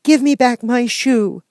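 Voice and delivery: put-on high, slow girl's voice, upset; a small girl demanding her shoe back; child voice, hurt and cross; angry little girl. A narrator's reading. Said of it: "grew angry and said to the witch".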